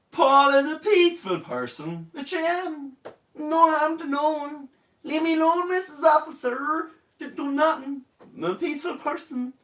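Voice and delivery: high, pleading voice of a petty crook; mock pleading suspect. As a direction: beg the officer to let you go. Plays a suspect pleading with police.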